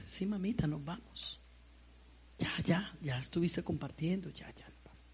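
soft firm whisper; hushed insistence. Retold or imitated. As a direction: urge the girl to leave without a scene. Mother quietly pulling daughter away. (susurro firme de madre)

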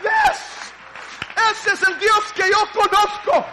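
high, loud, slow shouting proclamation; full-throated revival shout; preaching at full force. Acted. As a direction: proclaim God's faithfulness at the top of the voice.

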